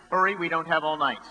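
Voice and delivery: showman presentation. Acted. Sideshow emcee presenting stunt.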